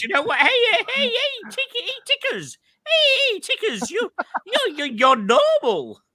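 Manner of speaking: very high squeaky impression of Marcelo. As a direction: mimic a high excited friend calling out. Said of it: "that's how he spoke as well".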